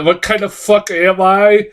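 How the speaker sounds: a touch of mock drama; imitating actress's odd delivery. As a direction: lightly mimic an unconvincing dramatic delivery. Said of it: "this girl's delivery of these fucking lines".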